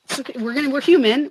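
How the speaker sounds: ordinary talk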